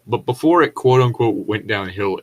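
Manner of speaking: ordinary delivery, nothing marked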